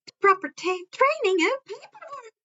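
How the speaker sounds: high, chirpy principal voice with a sly edge; Principal Netsu, high and chirpy. A narrator's reading. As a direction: pitch it up like a cheerful little animal principal.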